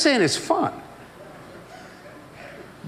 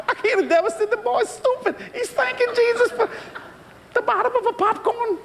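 much higher, mocking onlooker; 14 semitones higher than usual. Onlookers scoffing at the boy, high mocking voice.

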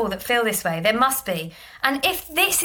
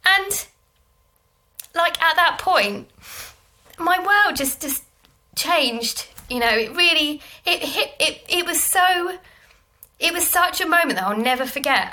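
real tearful catch, pitched higher, halting; choking up; 5 semitones higher than usual. Real, not acted. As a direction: fight back tears between long pauses. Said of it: "choking up already".

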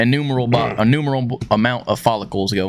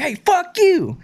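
high-pitched playful jab in a coworker's voice; mock coworker; much slower than usual. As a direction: fire it back as a friendly insult. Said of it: "He'd be like".

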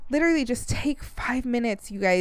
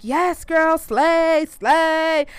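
put-on high, hyped girlfriend cheer; hyped-up bestie; 6 semitones higher, 4 dB louder, much slower than usual. Work besties cheering her on, high gushing praise. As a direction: gush like a hype friend. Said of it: "my work besties were like".

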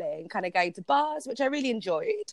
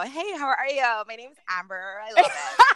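bubbly mock Valley girl accent, words ending with a laugh. London guest mocks Valley girl accent for the hosts, laughing. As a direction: play a ditzy California Valley girl for a laugh. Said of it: "maybe I can adopt a Valley girl".

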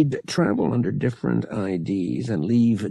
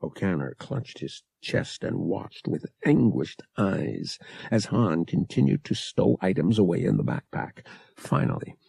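plain reading voice

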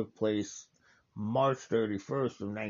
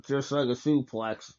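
usual speaking voice

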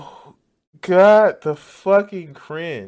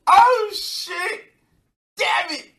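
swearing tirade; 10 semitones higher, 4 dB louder, slower than usual. Real, not acted. Reacting to clip, swearing outburst.

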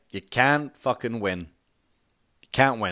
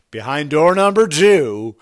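loud, drawn-out prize unveiling; game show host; 8 dB louder, slower than usual. Acted. Mocking the technician's big unveiling, game show host. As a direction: ham it up like a game show announcer.

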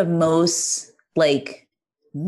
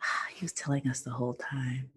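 hushed whisper of realization; 4 semitones lower, 14 dB quieter than usual. Retold or imitated. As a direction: breathe it out, voiceless.

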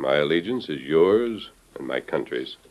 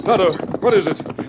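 calling out; 4 dB louder than usual. Acted. Calling to Tonto while riding up.

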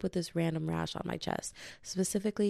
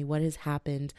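normal talking voice

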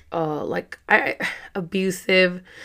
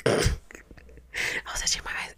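breathy whisper after a cough, slower; 9 dB quieter, slower than usual. Real, not acted. Apologizing to viewers in a faint whisper. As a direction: voice nearly gone, she whispers the line again.

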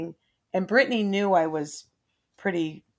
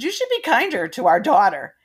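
teasing; 4 semitones higher, 5 dB louder than usual. Retold or imitated. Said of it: "I was teasing him".

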